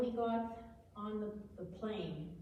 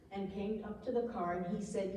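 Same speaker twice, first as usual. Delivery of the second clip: usual speaking voice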